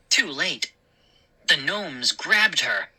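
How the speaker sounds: ordinary delivery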